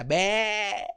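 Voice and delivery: soft bleating "Ve"; sheep bleat. Acted. A little sheep answering the cow with a soft bleat. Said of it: "también grita por allá y le responde a la vaquita".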